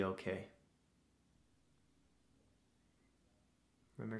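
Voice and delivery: soft, unhurried meditation voice with long pauses; guided meditation. Acted. Host leading listeners through breathing to calm anxieties, guided meditation. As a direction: guide them gently through each breath, leaving space.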